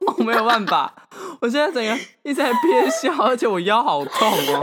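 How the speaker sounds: fighting back laughter; stifled giggles. Real, not acted. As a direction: giggles leaking through every word.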